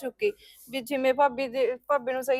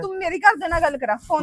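louder, fast insistent order; insist, furious; angry demand; 4 semitones higher, 9 dB louder, faster than usual. Acted. Girlfriend demanding to talk to his parents, angry. Quarrel with her boyfriend.

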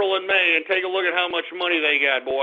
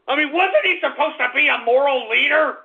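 higher-pitched indignant question; outraged; 5 semitones higher than usual. Real, not acted. Host questions a so-called moral leader, outraged. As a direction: push the question up in outraged disbelief.

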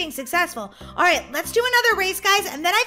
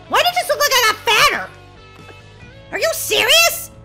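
high, loud disbelief; playful indignation; 9 semitones higher, 6 dB louder than usual. Real, not acted. Gamer looks like they got fatter, playful indignation. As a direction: protest it in high-pitched outrage.

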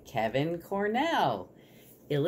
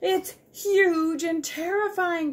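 high, slow wail of a terrified squirrel; crying out; 5 dB louder, slower than usual. Acted. Squirrel describing the danger to Sugar, crying out. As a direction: draw it out high in terror. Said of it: "cried the squirrel".

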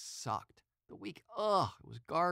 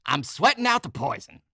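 "I'm sweating out the poison" yelled much higher and louder; punk rocker yell; 11 semitones higher, 15 dB louder than usual. Retold or imitated. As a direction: bellow it like a wild punk rocker. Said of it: "He always goes".

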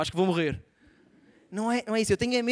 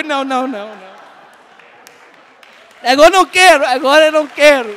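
loud, high hammed-up protest; mock shouted refusal; 4 semitones higher, 15 dB louder than usual. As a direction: yell it like a tantrum. (recusa aos gritos, exagerada e aguda)

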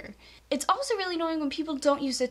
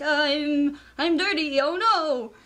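mock dirty doctor; 4 dB louder, slower than usual. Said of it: "be like the doctors".

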